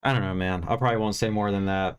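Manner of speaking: ordinary talk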